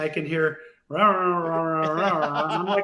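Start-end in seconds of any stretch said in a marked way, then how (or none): 0.9-2.4 imitated garbled radio chatter, all rah-rahs; unintelligible voice. Co-driver heard in the truck, imitated as wordless noise. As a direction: mimic speech with the words lost. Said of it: "alls I could hear was a voice".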